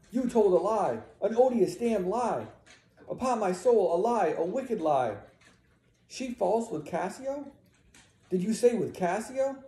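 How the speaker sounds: high, loud and indignant; outraged denunciation. Acted. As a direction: condemn the husband in disbelief. Emilia denounces Iago's lie.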